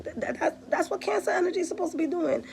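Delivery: high, fast and stammering with delight; excited stammer. Real, not acted. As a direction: blurt it out, thrilled. Laughing reply to a crying comment.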